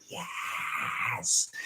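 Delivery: long drawn-out, voiceless "yes"; mock celebration in a stretched whisper; hammed-up delight. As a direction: relish it, soft and very drawn out. Acts out delight at staying inside.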